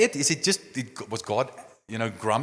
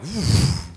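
mock stunned gasp; 5 semitones higher, 7 dB louder than usual. Crowd reaction after Uzzah struck.

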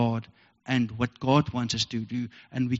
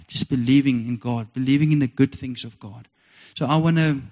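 ordinary delivery

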